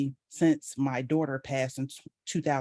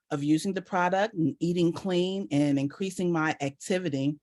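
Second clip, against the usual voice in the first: ordinary talk, nothing marked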